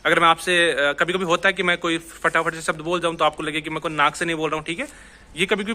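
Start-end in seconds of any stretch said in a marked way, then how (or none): none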